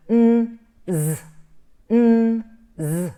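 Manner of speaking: n and z each held with a buzzing voice; voiced consonants. Shown as a demonstration. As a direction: hum a long loud n, then buzz the z. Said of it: "two consonants that have got sound or voice".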